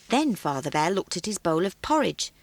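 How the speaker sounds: plain reading voice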